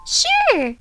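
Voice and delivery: high, loud excited burst; bursting sure. A narrator's reading. Pinkie bursting out her answer, high loud excited. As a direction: explode with eagerness. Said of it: "suddenly and literally bursted".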